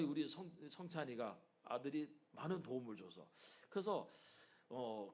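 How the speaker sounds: ordinary talk, nothing marked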